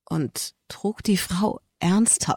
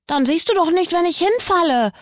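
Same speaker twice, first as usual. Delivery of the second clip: put-on much higher, anxious little boy voice; alarmed child; 12 semitones higher, 3 dB louder than usual. A narrator's reading. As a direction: sound suddenly worried, like a scared kid. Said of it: "kam es alarmiert aus seinem Mund".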